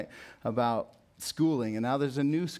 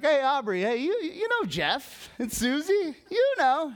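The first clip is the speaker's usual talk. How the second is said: high-pitched, louder chummy reminder; mock-cheery prompting; 12 semitones higher, 4 dB louder, slower than usual. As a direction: imitate yourself nudging your wife, singsong and high. Mimicking himself prompting his wife.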